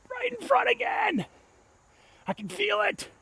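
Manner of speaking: very high, slow falsetto; strained falsetto. Real, not acted. Disc golfer seeing it land short, high falsetto. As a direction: squeak it out in despair.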